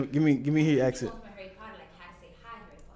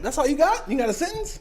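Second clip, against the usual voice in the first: normal talking voice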